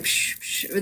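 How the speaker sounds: breathy whoosh. Retold or imitated. Imitates the rushing wind.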